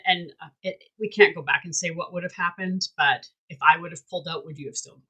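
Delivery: normal talking voice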